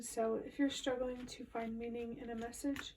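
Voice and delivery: ordinary delivery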